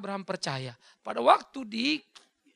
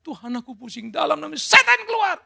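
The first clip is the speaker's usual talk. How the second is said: mock panicked prayer; 6 semitones higher, 11 dB louder than usual. Mocking a sick believer's prayer.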